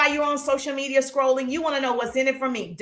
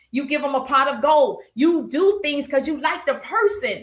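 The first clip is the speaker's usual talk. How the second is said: normal talking voice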